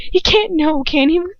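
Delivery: high, mocking know-it-all imitation; mocking Hermione voice. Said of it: "That's how I feel like she talks all the time".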